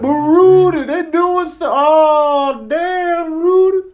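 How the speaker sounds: high, loud calling out to Rudy; alarmed call. Acted. Cat shouts urgently.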